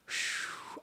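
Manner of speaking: soft, unvoiced puff of breath; exhaled phew. Real, not acted. Host letting out a breath after a bitter aside, soft exhale. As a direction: let out a whispery sigh.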